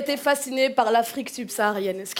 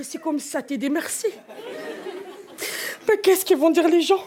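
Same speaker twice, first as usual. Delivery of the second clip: hammed-up slow motherly reproach; reproachful mother; slower than usual. Mother scolds the daughter she trusted, mock-tragic reproach. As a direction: lament a betrayal, dragging the words out.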